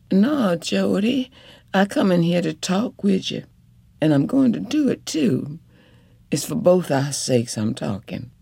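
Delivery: a wife pleading but firm at the bedside; determined wife. Acted. Wife voiced in story.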